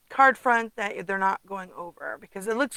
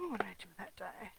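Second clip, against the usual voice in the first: whisper; 16 dB quieter, faster than usual. Real, not acted. Searching, talking to herself.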